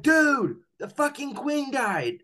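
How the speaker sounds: imitating stunned coworkers, very high pitched; high, drawn-out retold shock; shocked announcement. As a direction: break huge news in a high, slow mock cry. Coworkers break the shocking news.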